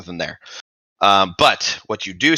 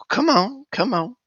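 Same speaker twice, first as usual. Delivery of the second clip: much higher, sing-song coaxing; coaxing call. Acted. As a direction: call pets over in a high coo. Lich calls his raccoons.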